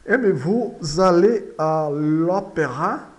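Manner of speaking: slow, loud French model sentence; deliberate, projected question for repetition; slow model reading. Shown as a demonstration. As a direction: read it out slowly and firmly for learners.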